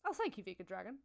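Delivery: ordinary talk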